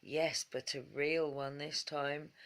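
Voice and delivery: plain reading voice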